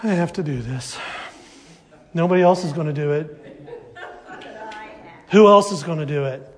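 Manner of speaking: low, soft mock resentful grumble; begrudging sigh. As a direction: complain like a tired martyr. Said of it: "Instead of".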